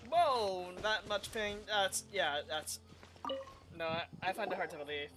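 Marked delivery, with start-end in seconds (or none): none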